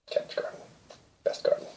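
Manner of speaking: hushed aside, barely voiced; breathy whispered aside. Real, not acted. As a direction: whisper it to the listener like a secret.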